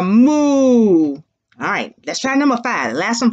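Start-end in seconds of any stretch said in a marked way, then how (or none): 0.0-1.1 long, loud imitated moo; drawn-out teacher's moo; cow moo. Shown as a demonstration. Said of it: "what does a cow say".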